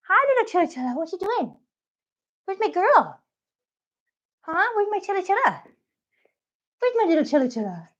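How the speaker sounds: high cooing pet voice. Real, not acted. Owner cooing to her cat Chilla, high baby talk.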